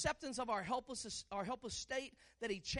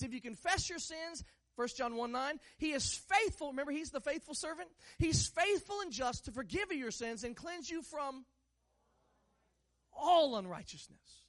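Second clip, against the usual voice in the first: ordinary talk, nothing marked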